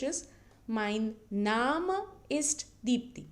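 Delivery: mein Name ist said slowly, Name as NAH-meh; careful German pronunciation. Shown as a demonstration. Teacher slowly modelling mein Name ist, not English name. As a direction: stretch mein Name ist so learners hear each sound. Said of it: "But the pronunciation is different".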